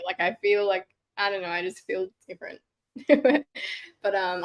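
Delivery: usual speaking voice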